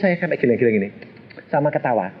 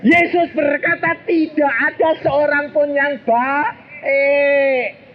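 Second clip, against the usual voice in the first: much slower, higher preaching emphasis; slow emphatic quote; 11 semitones higher, much slower than usual. Shown as a demonstration. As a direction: drag out every word. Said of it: "repeated slowly, emphatic". (kutipan diucapkan pelan dan tegas)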